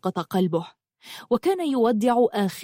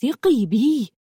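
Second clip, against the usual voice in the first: slightly raised, urgent plea; pleading; 3 semitones higher, 3 dB louder, slower than usual. A narrator's reading. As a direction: beg for trust. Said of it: "قال برجاء". (برجاء وإلحاح)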